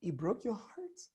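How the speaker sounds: tender near-hush; gently ask, very soft; low, soft tone. Shown as a demonstration. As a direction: drop to a hushed, soft question. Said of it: "your tone should really go low".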